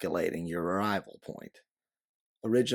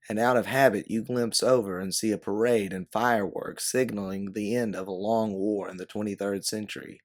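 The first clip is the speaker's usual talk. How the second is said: ordinary delivery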